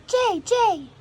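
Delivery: high, loud jay call; blue jay call. Retold or imitated. Narrator voices the blue jay calling its name, high and loud. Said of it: "The blue jay says".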